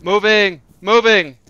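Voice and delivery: high, loud callout; shouting. Real, not acted. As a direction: bark it out while moving. Callout while pushing in.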